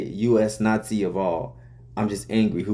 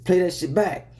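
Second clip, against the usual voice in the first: hyped request; 7 semitones higher than usual. Retold or imitated. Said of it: "And I'm like".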